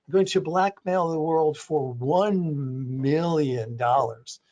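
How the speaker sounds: slow, low, drawn-out supervillain voice; Doctor Evil impression. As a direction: deliver the ransom demand like a smug movie villain, slowly, dropping low on dollars. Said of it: "like Doctor Evil again".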